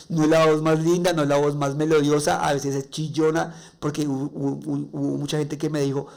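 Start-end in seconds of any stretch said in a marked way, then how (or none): none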